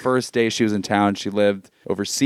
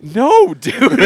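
high, loud, hurried no dude; panicked refusal; 14 semitones higher, 6 dB louder than usual. Retold or imitated. Retelling being punched, high loud panicked no. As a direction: backpedal fast, voice up high and loud. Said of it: "And I was like".

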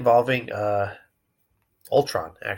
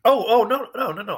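much higher and louder, bursting in; sudden excitement; 12 semitones higher, 6 dB louder than usual. Real, not acted. Cohost suddenly remembers the Thanos scene, bursting out excitedly. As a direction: blurt it out as the memory hits.

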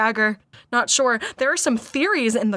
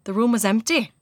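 put-on rough Irish accent, measured reading; amateur Irish accent, narrating; attempted Irish accent. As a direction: carry on the tale in an Irish lilt. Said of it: "This is really rough".

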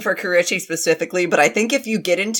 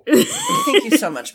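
gushing thanks; slower than usual. Pretend.

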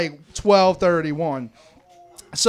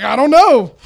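louder, higher blurted reply; Buffalo's answer; 6 semitones higher, 7 dB louder than usual. Retold or imitated. Dancing friend answering Josh, loud and higher. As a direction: toss it off loudly. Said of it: "he was like".

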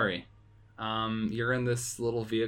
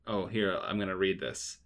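usual speaking voice

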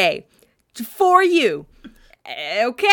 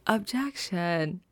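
quiet; 3 semitones lower, 9 dB quieter, slower than usual. Pretend. Slow, soft mock objection.

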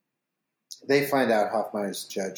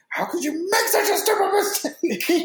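much higher, hammed-up yell of Jack scolding her; mock furious boss. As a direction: squeal it out like a ranting boss. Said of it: "And then he goes".

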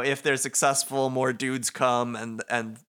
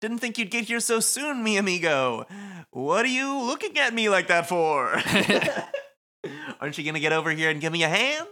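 much higher, sly villain voice with a laugh; smug Motoi voice; 8 semitones higher than usual. Acted. Motoi mocks Rindo for staring, high sly taunt. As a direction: tease him like a grinning schemer.

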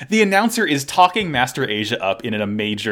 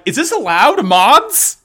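squeaky high indignant protest, louder; high mock outrage; 9 semitones higher, 5 dB louder than usual. Shuffle Lions complaining to the mods, high mock outrage. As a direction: pitch it up high and protest loudly. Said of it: "all the Shuffle Lions are like".

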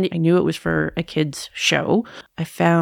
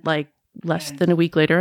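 ordinary talk, nothing marked

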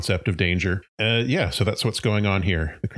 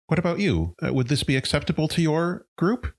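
higher, polite mayor voice; mayor asking the dog; 9 semitones higher than usual. Acted. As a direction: ask the dog kindly, pitched up. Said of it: "she turns to the dog and says".

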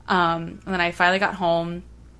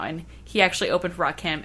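ordinary talk

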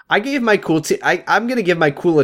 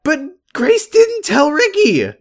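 high, slow put-on Ben voice; imitating Ben; 12 semitones higher, slower than usual. Recapping Ben's reply to Leo, high and slow. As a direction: drag it out, pitched way up, mocking. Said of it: "And Ben's like".

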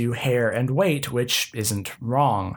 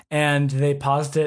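ordinary delivery

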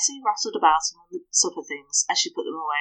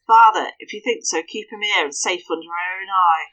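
urgent plea; 7 dB louder than usual. Acted. Hester begs father.

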